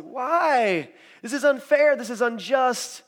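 high, loud cry of injustice; outraged protest. Acted. Jesus' friends after the trial, loud protest. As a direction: cry out against it, pitch lifted high.

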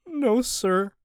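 high, defeated and submissive; broken. Acted. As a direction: sound utterly broken. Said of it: "sounding like an entirely different person. Broken".

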